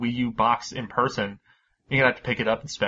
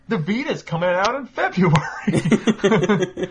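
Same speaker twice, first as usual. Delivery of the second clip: slow, drawn-out mock announcement, higher and louder with a laugh; mock retailer announcement; 8 semitones higher, 6 dB louder, much slower than usual. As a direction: lampoon a retailer's half-hearted promo line. Mocking a bored retailer.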